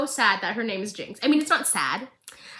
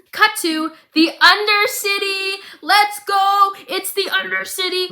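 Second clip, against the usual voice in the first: much higher, louder, slow and sing-song; excited; 9 semitones higher, 6 dB louder, slower than usual. Real, not acted. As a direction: hype it up like a favorite place. Excited about the Undercity.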